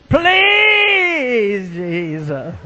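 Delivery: high drawn-out wail, sliding down; crying voice. Retold or imitated. Lady praying by the altar, mock crying wail. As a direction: imitate a long, pleading cry. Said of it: "a crying voice".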